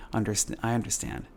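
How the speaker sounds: usual speaking voice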